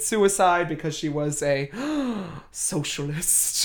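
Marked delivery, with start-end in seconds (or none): 1.8-2.5 high, scandalized little gasp; mock gasp. Telling co-host the wife's scandal, mock gasp. 2.5-3.6 soft, drawn-out word in pretend horror; mock scandalized. Telling co-host the wife's great crime, mock-scandalized hush.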